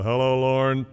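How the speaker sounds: lightly voiced dad greeting himself in the mirror; father's morning greeting. Father greets himself each morning, mildly imitated cheery hello. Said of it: "he'd flip on the light and he'd go".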